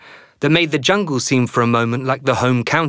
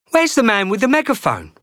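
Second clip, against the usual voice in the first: much higher, anxious question; a lost boy looking for the grown-up; worried boy; 10 semitones higher than usual. Acted. Fat boy asks anxiously.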